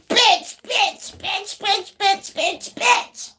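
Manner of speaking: high-pitched mock rant, laughing at the end; hammed-up high tantrum; mad person ranting. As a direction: squeal one curse over and over. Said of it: "this person like".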